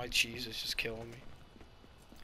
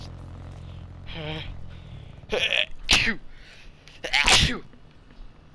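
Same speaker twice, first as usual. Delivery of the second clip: breathy build-up into loud sneezes; sneezing; 2 semitones higher, 6 dB louder than usual. Real, not acted. Gamer who didn't want to sneeze, loud sneezes. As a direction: gasp in, then burst out sneezing. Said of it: "I gotta sneeze".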